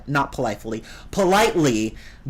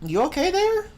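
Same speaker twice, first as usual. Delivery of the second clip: much higher, gently teasing question; high, sarcastic worried voice; mock concern. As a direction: ask it as if checking on a madman. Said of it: "I'm like".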